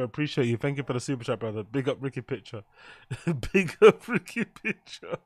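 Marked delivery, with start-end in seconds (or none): 3.0-5.3 slow, giggling through the name; amused slow repeat; laughing. Shown as a demonstration. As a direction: laugh out Big up Ricky Pitcher.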